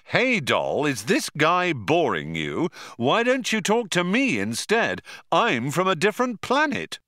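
lightly mock a smooth talker; imitating Zaphod's pickup line. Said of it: "barges up and says".

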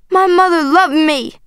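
high, hurt protest; crying out. A narrator's reading. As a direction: cry out to insist on it. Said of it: "I cried".

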